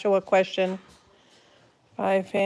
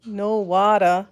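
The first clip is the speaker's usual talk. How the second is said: "No water", drawn out and slow; dragged out slowly; slower than usual. Shown as a demonstration. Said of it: "I'm not dragging it out fast enough".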